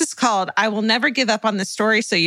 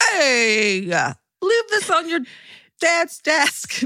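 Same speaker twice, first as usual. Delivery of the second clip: slow, higher, stretched attempt at a Boston accent; a laughing, failed try at a Boston accent; 7 semitones higher, much slower than usual. Co-host attempting Joey's line in a Boston accent. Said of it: "Can just try a Boston accent real quick".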